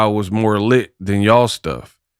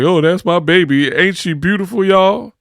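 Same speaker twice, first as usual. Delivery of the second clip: proud husband impression; 7 semitones higher than usual. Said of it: "[name] be like".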